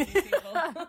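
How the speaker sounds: laughing while saying it; amused, half laughing. Real, not acted. Laughs about explaining to community.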